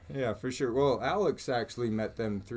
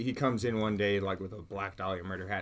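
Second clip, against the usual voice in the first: ordinary talk, nothing marked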